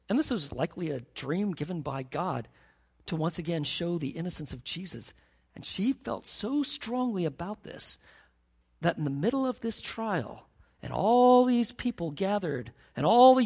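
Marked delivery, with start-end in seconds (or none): none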